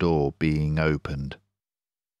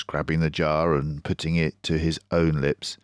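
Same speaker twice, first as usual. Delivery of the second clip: plain reading voice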